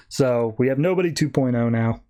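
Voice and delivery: normal talking voice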